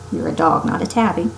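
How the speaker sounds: plain reading voice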